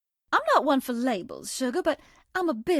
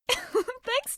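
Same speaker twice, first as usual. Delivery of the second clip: laughing thanks; 12 semitones higher than usual. Acted.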